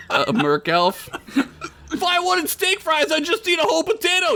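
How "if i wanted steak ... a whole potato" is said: high, fast goblin yell. Acted. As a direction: rattle it off like a cranky goblin. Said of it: "yelled a goblin".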